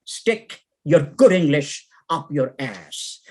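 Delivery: ordinary delivery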